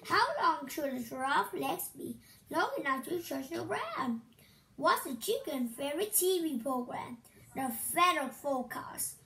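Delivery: ordinary talk, nothing marked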